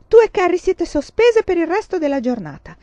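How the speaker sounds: high, loud, angry sentence; teacher's shout. A narrator's reading. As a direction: shout the punishment at both pupils. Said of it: "gridò la signorina Wilder".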